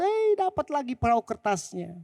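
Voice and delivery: put-on a kid getting his boat back; gleeful child's voice; happy child. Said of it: "Senang banget".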